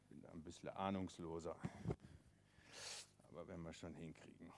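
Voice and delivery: low, soft, quick muttered aside; talking to yourself while busy; quiet aside. Real, not acted. Storyteller fiddling with the rope, quiet aside. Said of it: "narrator aside while tying".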